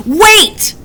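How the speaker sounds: high, loud alarmed wail. A narrator's reading. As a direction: cry out in alarm. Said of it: "he wailed".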